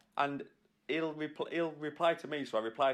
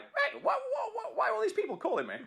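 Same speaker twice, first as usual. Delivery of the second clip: much higher, quick baffled mock voice; imitating Guy Martin; faster than usual. Guy Martin imagined getting flooded with calls, high baffled mock voice. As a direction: play a bewildered man swamped by calls. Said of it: "He'd be like".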